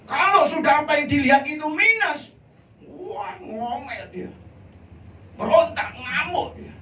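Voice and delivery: high, loud preacher's cry; loud, high-pitched sermon climax; fiery preaching. Acted. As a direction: thunder it at the congregation. Preaching at full force.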